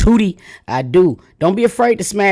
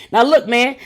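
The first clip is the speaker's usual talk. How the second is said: normal talking voice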